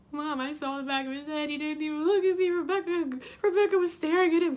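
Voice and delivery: hammed-up distraught complaint, rising in volume; mock wailing. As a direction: whine it to mom as if sobbing. Tearful text to mom, acted out.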